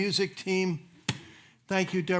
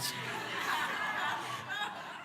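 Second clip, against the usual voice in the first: high breathy laugh; 9 dB quieter than usual. Real, not acted. Laughs mid-story.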